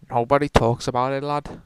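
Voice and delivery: loud, hammed-up Scots accent; a failed Scots accent, half-joking; bad Scots accent. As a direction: bellow it in a clumsy Scottish accent. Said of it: "I can't do a Scots accent".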